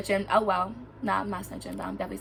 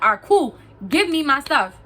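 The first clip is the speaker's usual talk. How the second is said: loud, higher insistent order; raised, impatient retold voice; firm demand; 5 semitones higher, 7 dB louder than usual. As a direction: act out demanding your things back. Acted demand to the girl.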